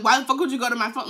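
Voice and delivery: loud, fast imitated outburst; blowing up. Boyfriend confronting her over his phone, blowing up. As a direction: mimic an angry boyfriend going off. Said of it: "he's blowing up at me".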